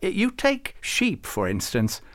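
put-on high, fussy old saint; Farouk. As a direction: lecture him with high-pitched fuss. Farouk argues about sheep.

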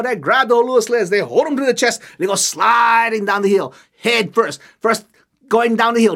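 ordinary delivery, nothing marked

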